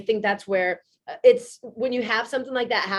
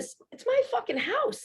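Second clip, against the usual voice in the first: outraged; 13 semitones higher, 4 dB quieter than usual. Retold or imitated. Furious retelling of neighbor.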